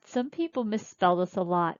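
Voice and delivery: usual speaking voice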